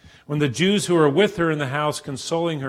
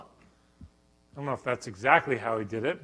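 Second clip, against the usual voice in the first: ordinary delivery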